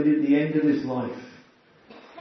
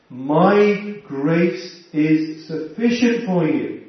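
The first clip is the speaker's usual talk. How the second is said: slow emphatic reading; 3 dB louder, much slower than usual. Shown as a demonstration. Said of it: "this word is true for every one of us".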